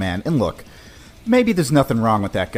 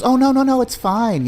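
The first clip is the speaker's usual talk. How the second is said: higher, breezy reassurance; imitating her; 13 semitones higher, 3 dB louder than usual. Girlfriend waving off his worry, higher breezy voice. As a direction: brush it off lightly. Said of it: "And she goes".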